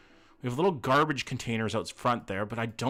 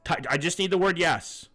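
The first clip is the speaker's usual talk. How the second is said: louder, raised host projection; game show host; 5 semitones higher, 4 dB louder than usual. Pretend. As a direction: address the contestant like a quizmaster. Hosts a round for chat contestant.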